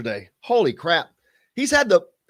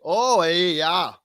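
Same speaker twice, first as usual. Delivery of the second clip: put-on Wisconsin accent; much slower than usual. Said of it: "The Northwoods".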